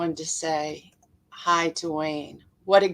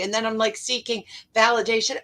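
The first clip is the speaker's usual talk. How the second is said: ordinary talk, nothing marked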